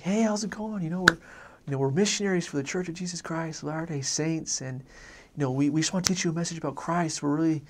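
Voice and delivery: high-pitched, overeager missionary pitch; overbearing enthusiasm, voice lifted; excited companion. Retold or imitated. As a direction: imitate an eager new missionary launching into his spiel. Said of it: "he gets really excited".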